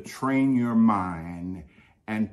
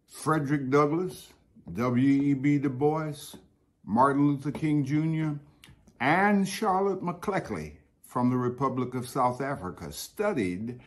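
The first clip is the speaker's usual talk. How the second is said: higher, ringing speech-making voice; rising oratory; 5 semitones higher than usual. Acted. Speaker extolling Wilberforce to the gathering, in rising, ringing oratory. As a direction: proclaim it like a rousing commencement address. Oration praising the university.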